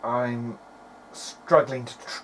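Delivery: ordinary talk, nothing marked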